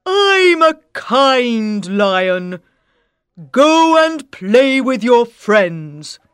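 loud, slow big-cat voice; a big lion being generous; kind lion. Acted. Lion lets the mouse go play, loud slow. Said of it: "says the lion".

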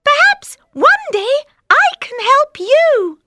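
very high squeaky mouse pleading; tiny mouse voice. Acted. Mouse begs the lion not to eat him, squeaky. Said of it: "says the mouse".

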